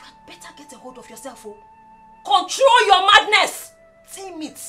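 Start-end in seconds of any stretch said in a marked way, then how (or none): 2.2-3.7 angry command. Acted. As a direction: lay down the law. Brother scolds sister.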